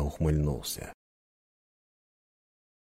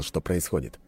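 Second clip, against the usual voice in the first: usual speaking voice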